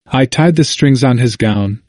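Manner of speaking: ordinary delivery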